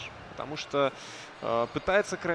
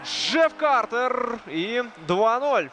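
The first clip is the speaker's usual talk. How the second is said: roaring goal call, long drawn out; excited hockey goal call; 8 semitones higher, 7 dB louder, much slower than usual. Real, not acted. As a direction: yell the goal, stretching it. Goal scored, commentator erupts.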